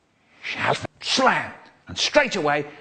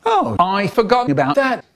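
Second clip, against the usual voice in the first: high voice; 10 semitones higher, 5 dB louder than usual. Retold or imitated. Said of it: "he says".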